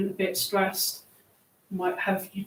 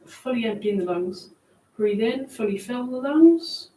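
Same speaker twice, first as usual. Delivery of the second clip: guided meditation; 5 dB quieter than usual. Acted. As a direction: ease them down gently. Leads a grounding meditation.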